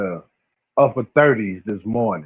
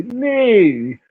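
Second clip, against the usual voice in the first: put-on West Coast accent; 11 semitones higher than usual.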